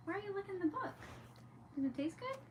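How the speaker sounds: high, sing-song questions to the dog; pet talk. Real, not acted. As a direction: coo at a puppy. Talking to her dog.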